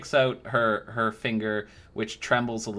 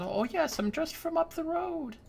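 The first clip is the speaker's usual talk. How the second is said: put-on high, sweet old woman voice, fawning; a doting old crone cooing over a young knight; sugary old lady flattering the customer; old crone baker; 5 dB quieter than usual.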